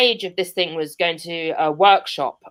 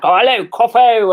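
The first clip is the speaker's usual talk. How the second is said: high, loud 1940s posh pilot voice; hammed-up posh British boyfriend; mock plummy wartime posh accent; ridiculously posh; 5 semitones higher, 7 dB louder than usual. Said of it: "I start doing a weird voice".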